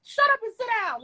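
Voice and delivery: high, quick retold scolding with laughter; mild mock yell, laughing. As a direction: playfully imitate telling someone off. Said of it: "Half the time we're yelling".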